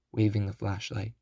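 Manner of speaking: ordinary delivery, nothing marked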